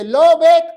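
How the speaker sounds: "Loved" loud and high, with the written -ed sounded out; ending pronounced. Shown as a demonstration. Preacher teaching the English past tense, "Loved" with a loud, spelled-out ending. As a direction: punch "Loved", making the E and D at the end audible. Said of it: "con E y D al final".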